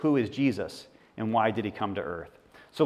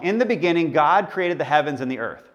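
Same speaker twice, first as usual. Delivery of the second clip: louder solemn reading of Genesis; scripture reading; 2 semitones higher, 5 dB louder than usual. Acted. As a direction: proclaim the verse from the screen. Reads Genesis on screen.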